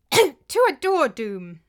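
high, loud summons with a small crack; voice breaking slightly. A narrator's reading. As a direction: let the voice break just a little. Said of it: "his voice breaking only a little".

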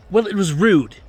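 fiercely. A narrator's reading. Manager laying into his employer, fierce. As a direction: land the verdict fiercely. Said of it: "laid into him so fiercely".

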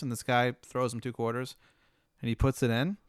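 normal talking voice

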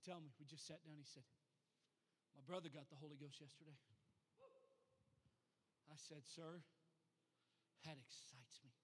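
soft, low storytelling; subdued, low-voiced pulpit anecdote; hushed testimony. Real, not acted. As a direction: tell it quietly after a big climax. Hushed testimony after the climax.

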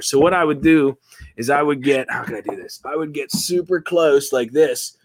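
ordinary talk

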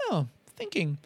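soft, contented mock guide; quietly pleased meditation teacher; Andy. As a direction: imitate a smug little murmur of serenity. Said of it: "with his little contented".

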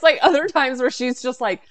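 ordinary talk

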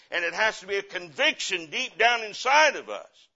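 loud, high, driving conviction; full-force preaching. Acted. Pastor urging the church to witness from conviction, preaching hard. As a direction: pound each line toward the pews.

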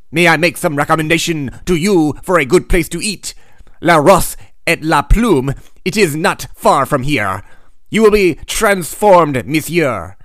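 put-on overdone French accent, savoring each word; comic French accent. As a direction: play a smarmy French street vendor with a thick French accent. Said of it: "I get to break out my flawless French accent".

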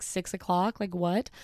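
usual speaking voice

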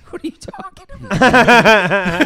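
high, loud, slow laughing question; laughing disbelief. Retold or imitated. Bewildered reply to compliment.

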